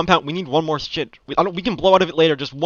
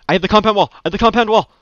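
high, loud excited callout; excited shout; 6 semitones higher, 5 dB louder, faster than usual. Real, not acted. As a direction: shout it to the squad.

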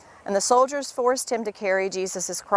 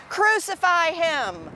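high, drawn-out mock shout; restrained crowd chant, high and stretched; crowd shouting back; 8 semitones higher, slower than usual. A narrator's reading. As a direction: cry it out slowly as the crowd. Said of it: "They shouted back".

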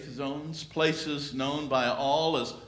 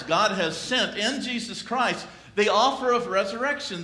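normal talking voice

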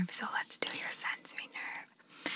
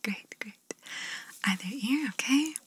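normal talking voice

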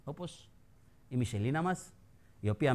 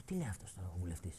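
quick aside; 13 semitones lower, 15 dB quieter, much faster than usual. Retold or imitated. Retold own thought, fast, quiet.

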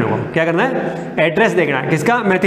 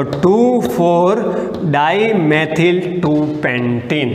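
each part of the name spaced out slowly; slow dictation; much slower than usual. Shown as a demonstration. Teacher dictating the compound name to the students, slow and deliberate. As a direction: spell out the compound name word by word. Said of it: "इसके बीच में comma लगा दिया".

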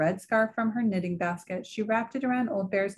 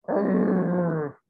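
bear growl. A narrator's reading. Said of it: "bear growls".

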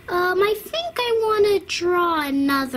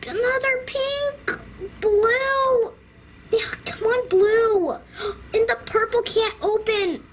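frustrated whimper; 6 semitones higher than usual. Acted. Rushing, upset painting.

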